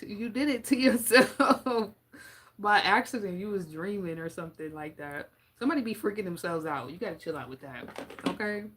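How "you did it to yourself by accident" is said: slow, laughing through the words; giggling it out; real amused laugh. Real, not acted. Laughing at own joke.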